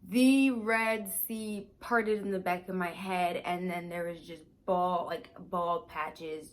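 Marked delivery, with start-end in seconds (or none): 0.1-3.4 slow, louder emphatic repeat of The Red Sea parted. Real, not acted. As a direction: say it again to hammer it home. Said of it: "Let me say that again for you".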